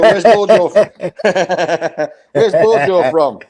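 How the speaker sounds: asking through real laughter; a teasing question, laughing as he asks. Real, not acted. Teasing about where Bojo's from.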